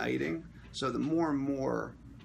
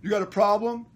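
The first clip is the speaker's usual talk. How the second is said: loud, raised challenge; louder, higher mock tough-guy demand; imitated confrontation; 9 semitones higher, 10 dB louder than usual. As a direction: play the guy picking a fight. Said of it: "he comes over to me. He's like".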